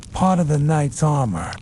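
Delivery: usual speaking voice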